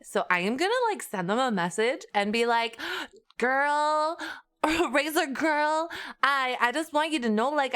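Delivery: put-on Valley Girl whine about a broken gadget. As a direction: play up a dramatic Valley Girl. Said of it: "too bad for the Valley Girl team".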